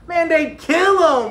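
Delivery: excited class cheer. A narrator's reading. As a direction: kids cheering it together. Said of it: "we all cheered".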